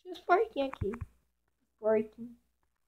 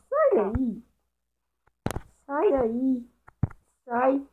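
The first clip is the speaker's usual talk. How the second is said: shooing away; 5 dB louder, much slower than usual. Real, not acted.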